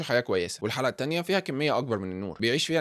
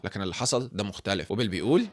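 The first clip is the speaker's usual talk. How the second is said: usual speaking voice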